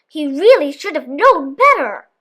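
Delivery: ordinary delivery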